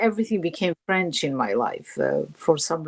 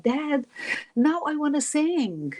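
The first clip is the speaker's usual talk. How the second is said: pleading; 9 semitones higher, slower than usual. Retold or imitated. Begging her father as a girl.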